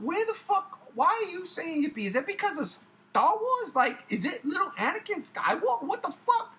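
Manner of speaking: high-pitched exasperated questioning; mock bafflement, pitch leaping up; driven crazy. Parent retelling questioning their child's yippee, exasperated and high. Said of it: "it drove me crazy".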